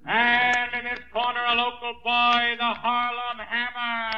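loud, slow ring introduction; ring announcer. Acted. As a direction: announce the fighter to the arena.

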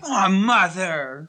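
put-on long drawn-out teenage whine; slow, exasperated plea; whining protest. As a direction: drag it out in complaint. Teenager protests parents' refusal.

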